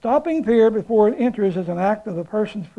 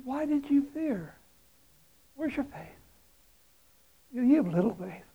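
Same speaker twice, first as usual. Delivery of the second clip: soft rebuke; 4 semitones higher, 8 dB quieter than usual. Pretend. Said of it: "He said".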